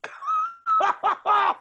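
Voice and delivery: high cackling laugh; shrieking laugh. Real, not acted. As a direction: burst into a shrill cackle. Laughs at crying moose.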